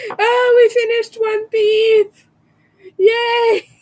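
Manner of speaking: high, loud celebration; excited cheer. Real, not acted. Crafter celebrating one finished piece, excited. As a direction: cheer with a long, high yay.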